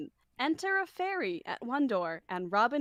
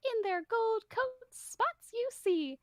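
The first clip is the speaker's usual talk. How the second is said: a higher cartoon fairy voice, slipping in and out; lighter Mickey Mouse voice; 5 semitones higher than usual. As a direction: keep the fairy squeaky, though it fades at times. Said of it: "You went full Mickey Mouse for this one".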